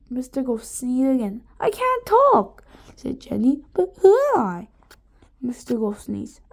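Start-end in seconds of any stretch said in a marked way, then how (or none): 1.6-2.6 much higher, slow squeak; a girl who lost her voice; panicked. A narrator's reading. Jenny panics, voice changed. 3.7-4.7 higher strange voice; confused. A narrator's reading. Voice swapped, confused.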